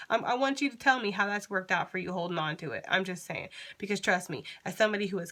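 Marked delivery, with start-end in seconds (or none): none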